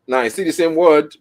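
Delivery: usual speaking voice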